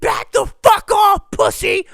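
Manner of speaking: put-on high, loud girl telling off a bully; fierce defending yell. Girl defending teenager from drunk heckler, fierce shout.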